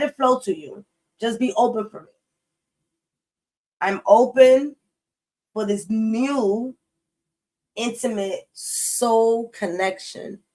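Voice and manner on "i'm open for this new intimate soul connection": slow, drawn-out affirmation. Shown as a demonstration. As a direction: speak it like a heartfelt mantra, very slow. Affirmation spoken as an example.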